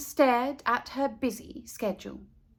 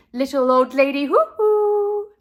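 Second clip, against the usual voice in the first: sing-song; 3 semitones higher, 8 dB louder than usual. A narrator's reading. Sing-song character name.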